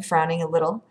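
ordinary delivery

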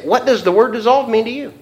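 high-pitched, insistent mock challenge; pointed questioning. Speaker rehearsing a challenge to a coworker, pointed questioning.

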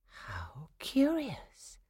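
slow, drawn-out whisper to oneself. A narrator's reading. Clara whispers to herself as the nutcracker comes alive, whispered. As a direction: murmur it softly, lifting on the last word. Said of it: "Clara whispered to herself".

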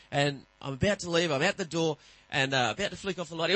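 normal talking voice

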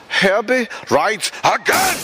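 hammed-up movie-trailer call; mock announcement. Joke exclamation.

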